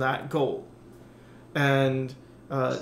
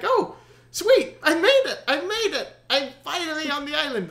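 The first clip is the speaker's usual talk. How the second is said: very high, elated cry; hammed-up joy at making it; triumphant relief; 12 semitones higher than usual. Rower reaches island.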